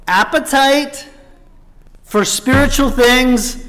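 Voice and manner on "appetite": Appetite drawn out slowly and higher; stress on Appetite. Shown as a demonstration. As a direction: lean on Appetite, slow and lifted. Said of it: "appetite is a sign of health".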